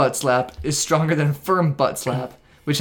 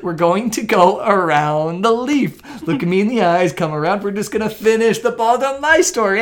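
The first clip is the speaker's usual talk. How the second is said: mock soothing guide; 5 semitones higher than usual. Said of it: "And I was like".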